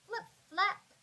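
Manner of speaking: ordinary delivery, nothing marked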